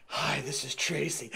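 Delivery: slow, low come-on intro; mock seductive Tracy; sultry phone voice.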